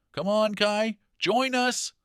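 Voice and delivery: high, loud call across the square; children calling out. Acted. Children calling Kai over to join their game, loud and high. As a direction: shout for him to come play. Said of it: "they called".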